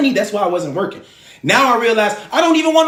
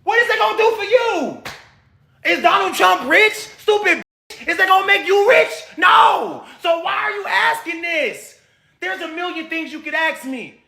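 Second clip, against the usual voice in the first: furious shout; 7 semitones higher than usual. Real, not acted. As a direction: yell at the chat, slowing down to hammer it home. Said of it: "'cause I'm so mad right now".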